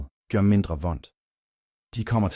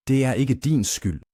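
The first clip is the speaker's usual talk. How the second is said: lightly voiced girl, higher and distinct; clear, firm reassurance; 6 semitones higher, 3 dB louder than usual. A narrator's reading. Little girl telling a grieving man he isn't to blame, clearly. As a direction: reassure clearly, with raised pitch. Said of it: "siger hun tydeligt".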